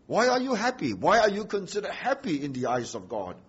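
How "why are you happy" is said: "Why" lifted high at the start of the question; stress on why. Shown as a demonstration. Preacher repeating his key question, "Why" pitched high. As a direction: lean on "Why", then let the question fall. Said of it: "the emphasis are, is on why".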